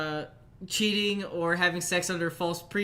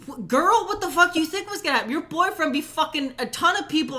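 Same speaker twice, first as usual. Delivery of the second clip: louder, higher, fast and incredulous; exasperated rant; 8 semitones higher, 4 dB louder than usual. Real, not acted. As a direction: fire it off in disbelief. Ranting at the girlfriend.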